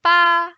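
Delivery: "Ba" high, level and loud; sustained high level "Ba"; first tone. Shown as a demonstration. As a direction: hold "Ba" on a high flat pitch. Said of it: "Let's try again".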